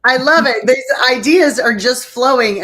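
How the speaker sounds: real excitement at a flood of ideas; excited. Real, not acted. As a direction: let the enthusiasm burst out loud. Host excited by guest's ideas.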